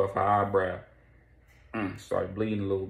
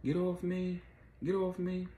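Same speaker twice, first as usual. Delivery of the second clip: high, slow pleading cry; panicked struggle; 10 semitones higher, 4 dB quieter than usual. Retold or imitated. Vicky thrown into a room, high pleading. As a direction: fight them off in a panic. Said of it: "So Vicky's like".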